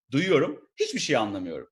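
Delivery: ordinary delivery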